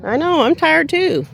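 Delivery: high, loud teasing scold; mock scolding the dog. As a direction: playfully tell off a whiny dog.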